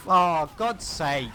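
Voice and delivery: loud, high, drawn-out exasperation; exasperated outburst. Real, not acted. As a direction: groan it out as play proves you wrong. Caster contradicted by live play.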